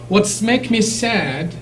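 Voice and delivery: ordinary delivery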